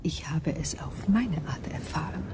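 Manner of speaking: alien whisper, breathy and hushed; eerie whisper. Acted. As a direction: breathe it like an unearthly being.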